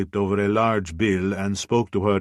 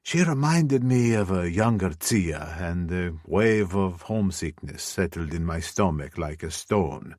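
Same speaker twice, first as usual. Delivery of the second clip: plain reading voice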